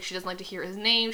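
usual speaking voice

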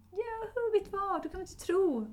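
bubbly mock excitement, stretched out; happy excited husband. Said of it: "Och han är så glad". (glad, uppspelt make i telefon, högt och långsamt)

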